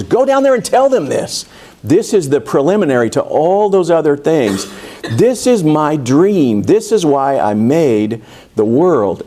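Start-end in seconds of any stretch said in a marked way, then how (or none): 0.0-1.4 much higher, urgent voice of God sending Moses; God commanding. Retold or imitated. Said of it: "he says to Moses". 5.1-9.2 slow, earnest voice of God; God confiding. Retold or imitated. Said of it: "God opening up his heart and saying".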